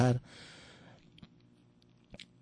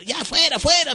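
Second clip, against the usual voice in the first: very high, sharp driving-out cry; retold yell of the priest; 4 dB louder than usual. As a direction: chase him out. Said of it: "me gritaba de acá".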